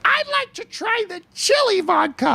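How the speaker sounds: very high put-on voice; high, silly mimicked request; tipsy woman impression. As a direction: imitate her in a squeaky high register. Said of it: "she was talking a bit like this".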